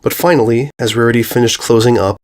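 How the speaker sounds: usual speaking voice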